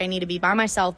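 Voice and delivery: ordinary delivery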